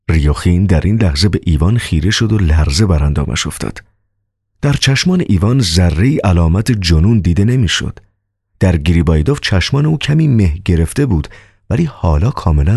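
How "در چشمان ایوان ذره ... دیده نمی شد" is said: usual speaking voice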